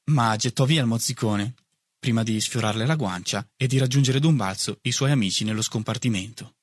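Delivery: ordinary delivery, nothing marked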